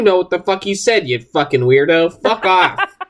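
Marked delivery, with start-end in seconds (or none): none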